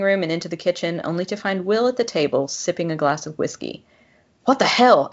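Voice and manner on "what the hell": sharp, higher startled cry; startled gasp. A narrator's reading. Walking in on him in the dark, startled. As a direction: caught off guard, blurt it out. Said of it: "I gasped".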